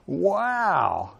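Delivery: soft, high, drawn-out wonder; amazed. Shown as a demonstration. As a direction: let it swell and fall in amazement. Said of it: "with an amazed facial expression".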